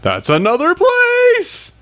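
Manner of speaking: high, slow mock amazement. Fans mocking the show's new places, in slow high amazement. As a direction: gasp it out like a wide-eyed fan.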